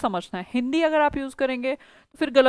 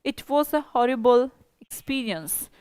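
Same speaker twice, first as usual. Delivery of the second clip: slow model sentence; much slower than usual. Shown as a demonstration. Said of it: "teacher models the sentence".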